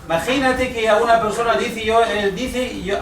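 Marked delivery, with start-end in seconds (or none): none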